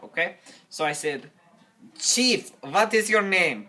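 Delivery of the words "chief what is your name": high, loud hail; calling out. Acted. Climber hails the chief at the fire, calling out high and loud. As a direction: call to him urgently, pitched up.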